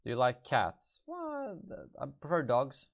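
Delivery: normal talking voice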